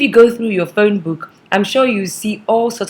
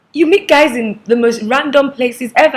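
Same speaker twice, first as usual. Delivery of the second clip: higher, louder teasing surprise; amused disbelief; 5 semitones higher, 5 dB louder than usual. Retold or imitated. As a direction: tease her with disbelief. Teasing friend about random places.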